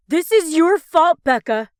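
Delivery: very loud, high outburst; furious shout. A narrator's reading. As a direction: blame her in fury. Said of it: "Ross protests with fury".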